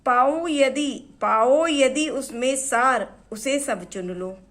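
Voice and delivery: हिंदी कविता का ज़ोरदार पाठ; emphatic recitation. Acted. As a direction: declaim the line.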